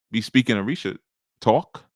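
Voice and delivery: normal talking voice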